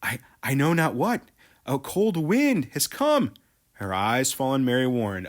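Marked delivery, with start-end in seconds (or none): 0.0-3.3 frightened. Acted. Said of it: "It is truly frightened".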